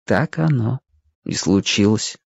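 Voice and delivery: ordinary delivery, nothing marked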